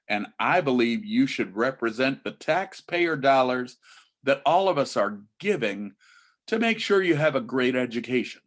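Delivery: ordinary delivery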